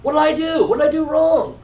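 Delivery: upset, high-pitched whine; David. Acted. David upset at being sent home, high plaintive protest. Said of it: "David's upset and going".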